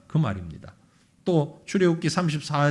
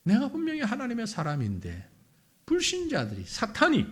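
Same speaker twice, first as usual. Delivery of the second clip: ordinary delivery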